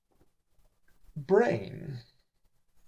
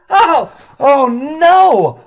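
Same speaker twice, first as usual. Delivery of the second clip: high, loud, drawn-out dismay; hammed-up horrified realization; dismayed cry; 14 dB louder, much slower than usual. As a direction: wail at a terrible mistake. Character realizes he chose wrong room.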